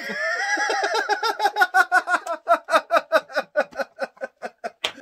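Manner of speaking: high, loud, sustained cackle of laughter; long belly laugh. Real, not acted. Listener cracks up at the ranting host, long high laugh. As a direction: burst out laughing and keep it going.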